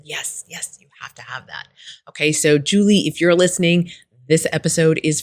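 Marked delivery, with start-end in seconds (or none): 0.0-1.7 hushed retold agreement. Coach agreeing with Julie's realization, hushed. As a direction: quote your reply softly, under your breath.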